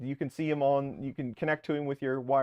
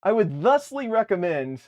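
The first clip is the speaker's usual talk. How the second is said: mock formal; 6 semitones higher, 6 dB louder than usual. Shown as a demonstration. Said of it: "I feel like I say that a lot".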